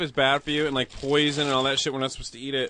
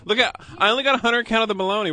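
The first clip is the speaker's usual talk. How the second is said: excited; 7 semitones higher, 4 dB louder, faster than usual. Real, not acted. Excited about the bologna.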